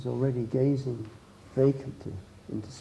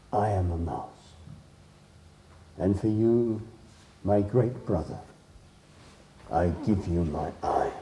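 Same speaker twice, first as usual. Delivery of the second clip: low, subdued offering with pauses; mouse, solemn sacrifice; 4 semitones lower, 4 dB quieter than usual. Acted. Little mouse gives the wolf his eye, low and solemn. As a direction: offer it quietly and gravely.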